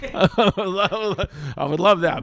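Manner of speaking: laughing through it, slow. Real, not acted. Joking about buying a house while Joe skins an elk, laughing. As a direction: laugh out the words at his own joke. Laughing at his own joke.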